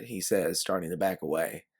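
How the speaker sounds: plain reading voice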